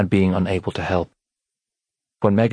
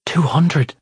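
high, louder shocked gasp; 9 semitones higher, 3 dB louder than usual. A narrator's reading. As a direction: gasp it out, stunned mother. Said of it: "his mother gasped".

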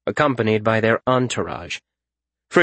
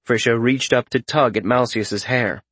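usual speaking voice

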